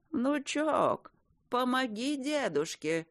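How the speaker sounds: a granddad calling feebly for help; old grandfather. A narrator's reading. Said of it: "молодцеватый голос деда". (старческий зов внучка, тише и медленнее)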